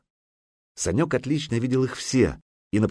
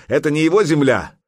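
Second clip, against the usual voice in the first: high, loud protest; yelling; 7 semitones higher, 6 dB louder than usual. A narrator's reading. Грейпюр protests about whose land it is, yelling. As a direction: shout it out indignantly. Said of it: "заорал Грейпюр".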